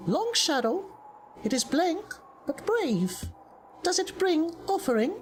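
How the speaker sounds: high-pitched, evenly held creature voice; Jin, bargaining creature. Acted. Jin asks a visitor for an offering, high stilted creature voice. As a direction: speak high and stilted, like an odd little trader.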